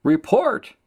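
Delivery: loud, very high taunt; mocking. Mockers calling out against Jeremiah, mocking. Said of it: "they're mocking him, saying".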